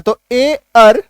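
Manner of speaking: ए अर stretched slowly and apart; slow sound demo. Shown as a demonstration. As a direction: drag out ए अर slowly. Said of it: "अर का sound निकालता है". (ए अर को धीरे-धीरे खींचकर बोलें)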